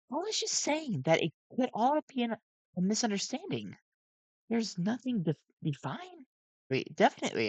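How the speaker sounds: high, nervous animatronic voice; jittery gentle robot voice; Sun voice. A narrator's reading.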